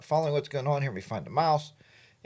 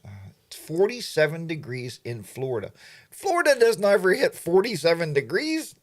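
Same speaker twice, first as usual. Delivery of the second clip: usual speaking voice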